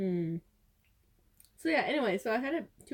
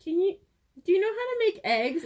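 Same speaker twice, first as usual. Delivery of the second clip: mock innocent ask; 9 semitones higher, 6 dB louder than usual. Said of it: "I'm like".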